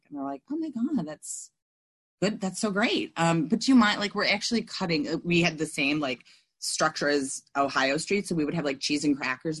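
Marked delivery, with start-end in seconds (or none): 0.5-5.1 high, overly cheerful mock; hammed-up perky member; chirpy AA voice. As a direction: gush as if everything's wonderful.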